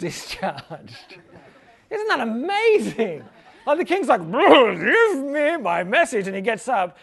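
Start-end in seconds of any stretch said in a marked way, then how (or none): 4.2-6.3 slow, higher, eager king voice; hammed-up impatient king. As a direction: stretch it out like a greedy king. Eager king asks for message.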